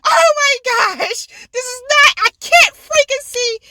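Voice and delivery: high-pitched, exasperated retelling; dramatized, worked-up complaint; retold frustrated rant. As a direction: go in on a mock tirade, high. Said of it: "I'm just going in".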